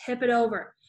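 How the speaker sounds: ordinary delivery, nothing marked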